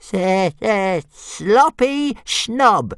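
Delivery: high, very slow dragon jeer. A narrator's reading. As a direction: stretch the taunt out with a stutter. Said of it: "jeered Toothless".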